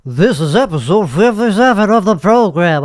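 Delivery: hammed-up nasal announcement, pitch wavering up and down; ultra nasally voice. Host announces the episode number, mock nasal and wobbly. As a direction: announce it through your nose with an exaggerated wobble. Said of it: "ultra nasally voice".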